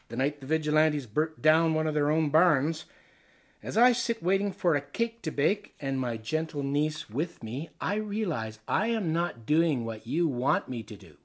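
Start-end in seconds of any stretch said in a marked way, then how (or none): none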